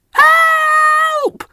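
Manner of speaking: very high shout; Percy crying help. A narrator's reading. Runaway little engine shouting for help, very high. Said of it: "cried Percy".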